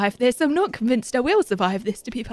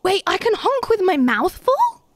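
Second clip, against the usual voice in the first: high, rising to an incredulous question; surprised question; 6 semitones higher, 4 dB louder than usual. Real, not acted. Player discovers a new honk trick, surprised and questioning. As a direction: ask it in amazed delight, swooping up at FULL.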